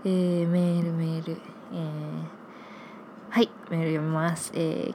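ordinary talk

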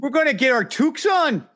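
high, hammed-up Canadian accent. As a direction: put on a jokey Canadian accent for your friend. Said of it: "you've got a nice Canadian".

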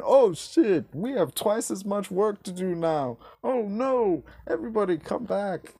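high-pitched mock panic; exaggerated high, panicked voice; panicking boss impression. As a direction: play a boss crying out in sudden regret. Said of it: "and going".

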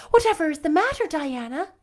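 high, loud startled cry; cried out. Acted. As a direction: call out in alarm at the friend bursting in. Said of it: "cried Anne".

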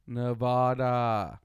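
"Nevada" very slowly, nuh-VAH-dah; slow, insistent "Nevada", middle like spa; drawn-out pronunciation. Shown as a demonstration. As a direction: stretch "Nevada" out with open AH vowels.

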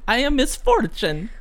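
high, little-lady game character voice; high-pitched video game heroine impression; Misfortune. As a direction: put on a tiny, high girlish character voice. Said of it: "that fucking video game you played".

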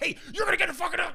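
fast, high, shouted order in a put-on voice; imitated shout. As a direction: bark it like a security guard. Security yelling at drunk friend.

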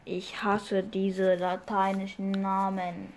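slow, drawn-out complaint; fed up. Real, not acted. Podcaster stumbling over Latin names, fed up. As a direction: drag out each word in weary annoyance.

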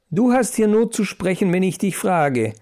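scolding bellow, held back; roaring. A narrator's reading. Willibald silences Lillimaus, mildly roaring. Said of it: "brüllte der überaus starke Willibald".

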